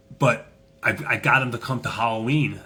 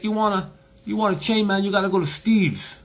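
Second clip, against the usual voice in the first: put-on high, louder Jamaican-accented voice; Jamaican man; 11 semitones higher, 5 dB louder than usual. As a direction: imitate an eager Jamaican tipster, pitched way up. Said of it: "Jamaican guy".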